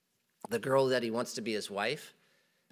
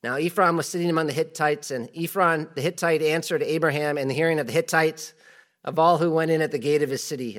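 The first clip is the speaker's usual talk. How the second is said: raised, louder Bible reading voice; scripture reading; 4 semitones higher, 6 dB louder than usual. Acted. Preacher reads scripture aloud to church, louder. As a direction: read the verse out to the congregation.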